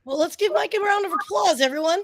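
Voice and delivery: high, bright host call to the crowd; rally the audience to clap; calling for applause. Real, not acted. Calls for applause on air.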